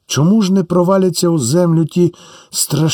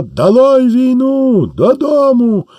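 much higher, slow, drawn-out shout; soldiers' cry; 9 semitones higher, slower than usual. Acted. Deserting soldiers shouting to go home, high and drawn out. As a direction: yell it out as a mutinous crowd.

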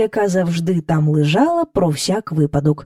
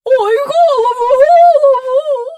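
much higher, louder, slow wail; pleading cow; 7 dB louder than usual. Acted. Cow begs as courtiers pull the star, wailing. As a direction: beg for your head in pain. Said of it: "благала корова".

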